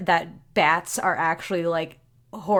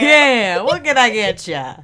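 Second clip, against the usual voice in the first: put-on barkeep with a broad Boston accent; louder, hammed-up Boston accent; 4 dB louder than usual. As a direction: play it up as a Boston bartender. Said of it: "we're going Boston".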